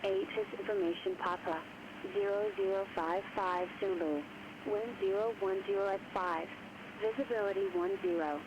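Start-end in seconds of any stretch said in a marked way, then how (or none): none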